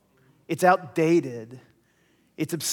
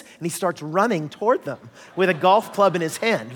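ordinary delivery

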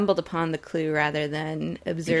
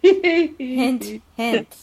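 louder, teasing nudge on hint; knowing hint hint; 7 semitones higher, 4 dB louder than usual. Pretend. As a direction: wink it to them, a bit louder. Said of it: "you forgot, uh, the words hint, hint".

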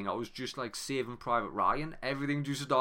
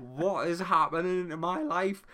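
higher and louder, a mock frantic cry; retold panicked yell; 6 semitones higher, 5 dB louder than usual. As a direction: throw it up like you're losing it. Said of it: "I was screaming".

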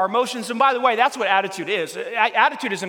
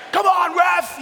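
mock shout at the ref, high and loud; athlete yelling; 11 semitones higher, 6 dB louder, much slower than usual. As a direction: yell at the referee like a sore athlete. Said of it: "they go over to the".

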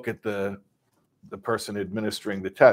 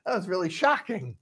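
higher, hammed-up pained reaction; mock shocked man; 10 semitones higher than usual. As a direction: ham up the zapped victim. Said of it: "He's like".

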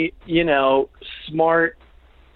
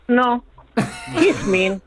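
quoting coworker; 8 semitones higher, much slower than usual. Retold or imitated. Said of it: "He goes".

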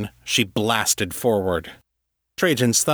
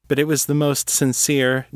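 ordinary delivery

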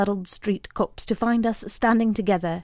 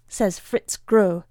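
ordinary delivery, nothing marked

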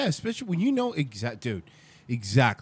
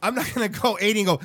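ordinary talk

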